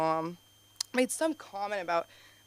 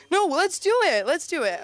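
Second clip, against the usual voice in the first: energetic hype; 9 semitones higher, 7 dB louder than usual. Real, not acted. Hyping up the crowd.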